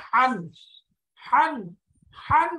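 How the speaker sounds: "হান" from mid-throat, high and loud. Shown as a demonstration. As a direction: push "হান" out from the middle of the throat. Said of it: "গলার মাঝখান থেকে".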